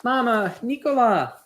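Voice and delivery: lightly voiced dying father; father's plea. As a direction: reach out to them with the last of his strength. Dying father calls to family.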